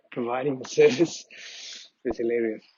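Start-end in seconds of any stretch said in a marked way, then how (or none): none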